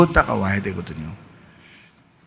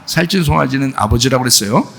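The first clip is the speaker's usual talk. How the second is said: ordinary talk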